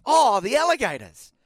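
high, slow mimic of his reply; mocking imitation. Host parroting the guest's line to the Prime Minister. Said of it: "what were you thinking with".

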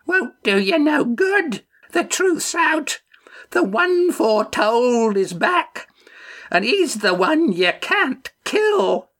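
very high, slow crone's quaver; quavering old woman. A narrator's reading. Old woman foretelling to Mufrid, high quavering wail. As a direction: wail it out slowly like an old prophetess. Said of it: "Pegeen quavered".

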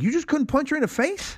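high-pitched disbelieving question; retold incredulity. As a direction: push the pitch way up in mock disbelief. Acting out an incredulous film question.

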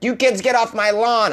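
mock old-man yelling at kids; old guy ranting. Podcaster mocking his own rant as an old guy yelling at kids, cranky. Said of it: "I sound like an old guy".

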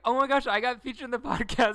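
high, loud thrilled squeal; excited fan. Retold or imitated. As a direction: gush like a fan who just got a shout-out. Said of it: "Scout's gonna be like".

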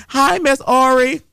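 high, slow sing-song hello; drawn-out greeting. Real, not acted. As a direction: stretch the hello out high and sweet. Greeting caller joining by phone.

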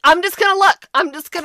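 mock brave. Kirsten volunteering bravely, retold.

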